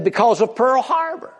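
a hammed-up offhand answer.

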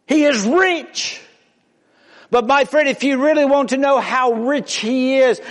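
high, loud preaching call; rising preaching. Real, not acted. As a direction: lift the voice and call out to the congregation. Preaching at full force.

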